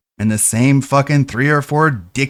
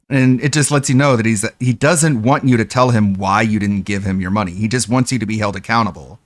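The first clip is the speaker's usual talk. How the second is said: ordinary talk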